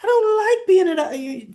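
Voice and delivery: high, loud whiny pout; mock Carly sulking about her brother; pouty whine. Imitating Carly pouting to Jack, high whiny voice. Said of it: "That's why I gotta do the pouty face".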